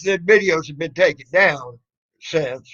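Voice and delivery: normal talking voice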